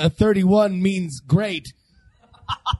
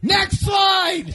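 shouting; 12 semitones higher than usual. Real, not acted. Calling out to slide operator.

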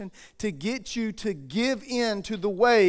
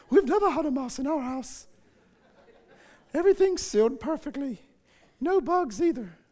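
put-on higher-pitched older British lady voice; older British woman; 4 semitones higher, 3 dB quieter than usual. Preacher mimicking churchgoers denying mice, as an older British woman. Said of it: "talking in an older British woman voice".

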